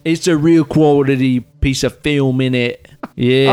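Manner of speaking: put-on lightly done British accent; a hint of a British accent. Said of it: "Our British accents are fucking superb".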